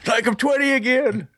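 put-on high, cracked old-timer voice; old prospector. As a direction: play a creaky old geezer. Mocking old man staying up.

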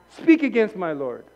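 loud, high sermon cry; rally them with fire; preaching at full force. Real, not acted. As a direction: passionate preacher.

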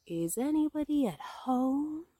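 high, drawn-out call with little added volume; calling out insistently. A narrator's reading. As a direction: repeat the call slowly, stretching it out. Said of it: "called Pooh very loudly".

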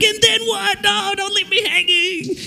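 high-pitched, hammed-up pleading; mock desperate listener. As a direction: squeal in mock agony at the cliffhanger. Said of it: "I'm like".

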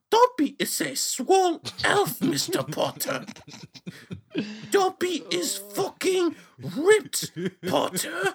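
very high, slow squeaky house-elf; Dobby voice. Acted. Friends doing their Dobby voice, high slow squeak. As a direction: screech it slowly like a buff Dobby. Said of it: "our Dalby voice".

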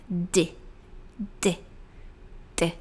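"D" as a short puff of air; puffed D sound. Shown as a demonstration. Teacher isolating the English D for learners, softly puffed. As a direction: say "D" as just the airy consonant, very briefly. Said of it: "ele tem esse som sopradinho".

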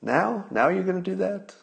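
high, disbelieving mock reaction to the tweet's timing; incredulous now. Host mocking the president's timing, incredulous and high. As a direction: act baffled, pitch climbing on each now. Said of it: "It's like".

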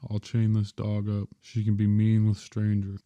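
ordinary delivery

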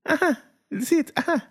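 high, gloating little uh-huh; teasing I-told-you-so. Pretend. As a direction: tease her with high-pitched, knowing grunts. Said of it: "you're gonna see the little twangs that come out".

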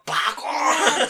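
loud, high-pitched shouted impact noise; explosive comic sound effect; mock bat crack. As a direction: belt out a home-run crack. Acting out a big home-run hit.

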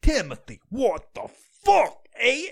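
slow, drawn-out New York Guido; strained mock Guido. Mocking a Guido calling to Timothy, strained Guido accent. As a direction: grunt it as if cracking your back. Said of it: "trying to crack their fucking back".

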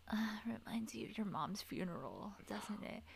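hushed, unvoiced mock whisper; whispered mock of Blaine's line; creepy whisper. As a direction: breathe it like a creepy aside.

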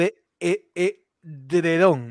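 slow, halting, forcing the word out; stumbling over edredón; struggling to say it. Real, not acted. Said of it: "no puedo".

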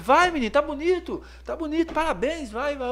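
put-on high cheering girly voice; imitating cheering girls. Shop girls cheering him on, high voice. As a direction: squeal their praise up high.

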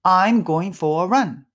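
slow, clear English model line; slow model phrase. Shown as a demonstration. Teacher repeats the phrase for students to copy. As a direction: model it slowly, leaving a gap to repeat. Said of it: "repeat after me".

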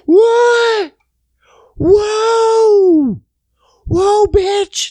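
high, loud wailing shouts; drawn-out falsetto mock yells; kids freaking out. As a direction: imitate shrieking kids in alarm. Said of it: "all these kids freaking out".

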